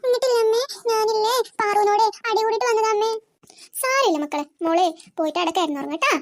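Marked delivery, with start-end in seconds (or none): 3.8-6.1 much lower, calm motherly voice; low, soothing mother; mother consoling. Acted. The mother consoles her daughter, much lower and calm.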